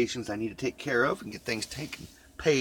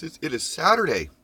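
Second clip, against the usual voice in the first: ordinary delivery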